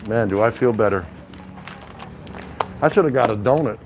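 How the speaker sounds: usual speaking voice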